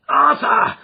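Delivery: screamed name, high and loud. A narrator's reading. Father catching his son, screaming his name. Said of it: "I screamed".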